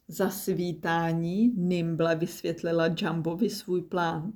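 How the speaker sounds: ordinary delivery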